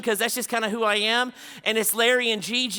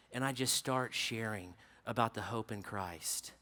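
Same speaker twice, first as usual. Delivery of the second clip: hushed, low narration with long pauses; solemn storytelling; 6 semitones lower, 11 dB quieter than usual. Real, not acted. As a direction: tell it softly and gravely, as at a deathbed. Solemn story of dying neighbor.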